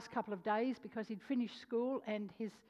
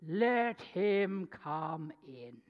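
slow commanding call; 4 semitones lower, 3 dB louder, much slower than usual. Retold or imitated. Voice from inside yelled out.

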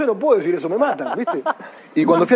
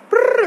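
high trilled doorbell noise; timbre imitado. Retold or imitated. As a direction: imitate a buzzer with a rolled trill. (imitando un timbre, agudo)

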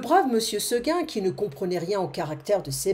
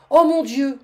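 loud, high cry of dismay; much louder, higher stupefied exclamation; stunned cry; 5 semitones higher, 7 dB louder than usual. Acted. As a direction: cry out in shocked disbelief. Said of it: "cria monsieur Seguin stupéfait".

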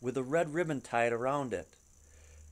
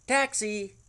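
higher, louder hail for a cab; calling a taxi; 8 semitones higher, 5 dB louder than usual. Acted. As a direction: call out to flag a taxi. Hailing a cab in lobby.

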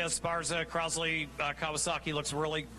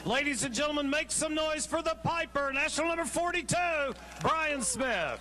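high, ringing crowd introduction; announcer hype; 6 semitones higher than usual. Real, not acted. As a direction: pump up the stadium for the winner.

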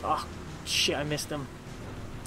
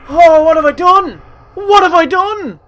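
high, very loud melodramatic cry; mock-horrified wail; 11 semitones higher, 15 dB louder than usual. Player aghast at own game blunder, mock-horrified shout. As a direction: wail it in over-the-top regret.

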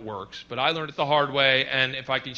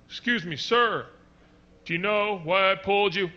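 higher, louder polite cop; 6 semitones higher, 4 dB louder than usual. Retold or imitated. Cop asking driver at window, mock polite. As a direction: ask it like a stern officer. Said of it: "let him ask you again".

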